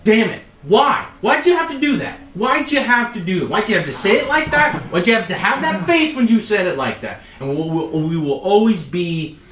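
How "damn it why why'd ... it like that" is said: high, pleading 'why' outburst; exasperated protest. Acted. Wounded man protesting to whoever poked the wound, exasperated. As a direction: pile up aggrieved questions, pitched up. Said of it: "It's like".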